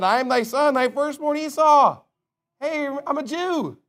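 a lightly voiced Esau, high and slow; mock Esau. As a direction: play Esau begging to be recognized. Voicing Esau before Isaac.

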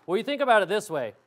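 ordinary delivery

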